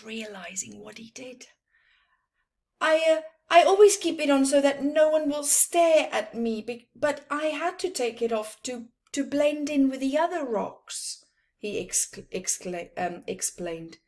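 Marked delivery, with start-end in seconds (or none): 2.8-11.2 nervous explanation. Acted. Embarrassed camel explains.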